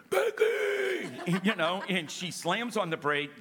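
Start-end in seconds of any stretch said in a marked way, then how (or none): 0.1-1.4 sudden high yelled name; startled scream of a wife's name. Retold or imitated. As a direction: yell her name in alarm. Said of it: "And I screamed".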